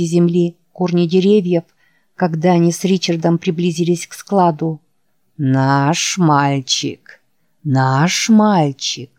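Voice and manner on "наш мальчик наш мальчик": low, very slow, drawn-out monster call; eerie creature voice.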